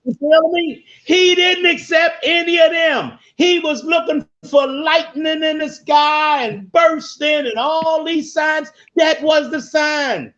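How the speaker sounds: high, shouted preacher's punchline; fired-up preaching. Real, not acted. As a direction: preach it high and insistent, stretching the key phrases. Preacher drives home the story's punchline.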